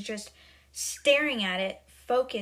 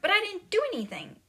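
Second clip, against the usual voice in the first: high, quick plea of innocence; protesting innocence; 10 semitones higher, 4 dB louder, faster than usual. Retold or imitated. Matilda answering the headmistress, high protesting innocence. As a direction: act out a child's hurried objection.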